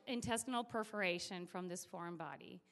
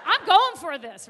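gung-ho shout, higher pitched; much louder, fired-up declaration; loud determination; 4 semitones higher, 16 dB louder than usual. Acted. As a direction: announce an all-out attempt. All-out determination.

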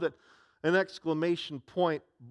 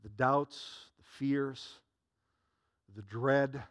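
quiet preaching; 5 semitones lower, 5 dB quieter than usual. Acted. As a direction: draw them close with a hush. Soft reflective sermon close.

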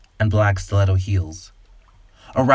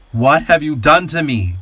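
louder, higher anguished cry; a man crying out louder and higher; 6 semitones higher, 5 dB louder than usual. A narrator's reading. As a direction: wail it in anguish. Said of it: "cries, grow louder".

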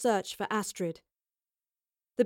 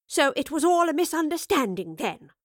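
higher, mocking disbelief; scoffing; 6 semitones higher than usual. A narrator's reading. Professor doubting Evie's account, scoffing. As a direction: scoff at the excuse. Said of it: "the professor scoffed".

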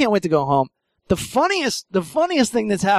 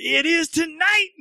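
high, slow delighted answer; gleeful reply; 11 semitones higher than usual. Retold or imitated. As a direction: savour the yes with glee. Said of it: "He's like".

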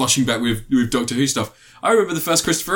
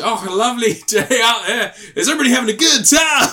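high, loud Scottish stage greeting; hammed-up Scottish accent, pitched way up; 11 semitones higher, 4 dB louder than usual. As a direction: imitate a Scot hailing a crowd, building to a shout. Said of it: "slips in the Scottish accent so often".

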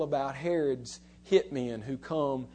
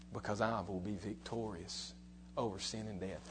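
tender Jesus voice; 4 semitones lower, 6 dB quieter than usual. Acted. Jesus answers Jacob in poem.

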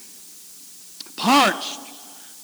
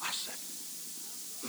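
whisper; 21 dB quieter than usual. Real, not acted.

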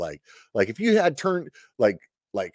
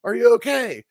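higher, calling out to a downed buddy; lightly voiced fellow soldier shouting his name; worried call; 12 semitones higher, 4 dB louder than usual. Fellow soldier calls to him after the lightning strike, worried shout. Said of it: "And he's like".

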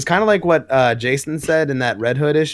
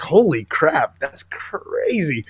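drawn-out amazed; 4 semitones higher, much slower than usual. Retold or imitated. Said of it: "And I was like".

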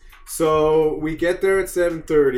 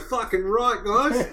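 high mock Aussie, amused; goofy Australian cheer; Australian accent; 5 semitones higher, slower than usual. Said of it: "He's doing Australian now".

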